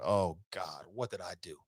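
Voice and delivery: low, soft groan of regret; dismayed hush. Retold or imitated. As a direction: mutter it low, sinking in dismay. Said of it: "I'm like".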